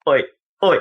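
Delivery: short spaced oi calls to get attention; gentle repeated oi; older kid calling. Retold or imitated. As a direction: call oi to a hurt kid. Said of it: "kneeling over him going".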